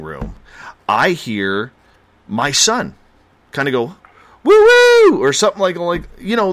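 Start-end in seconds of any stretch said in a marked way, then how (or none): 4.4-5.2 high imitated child's whoop; happy kid screech. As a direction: imitate a kid's happy little squeal. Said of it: "little happy screechy sounds that kids make".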